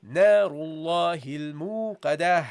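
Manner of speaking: تلاوة مجودة; Quran recitation. Shown as a demonstration. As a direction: recite نار الله الموقدة melodically. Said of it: "That's how you pronounce it".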